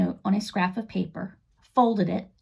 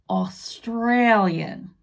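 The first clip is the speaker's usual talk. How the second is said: Australian accent; much slower than usual. Shown as a demonstration. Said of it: "sounding like someone from Australia".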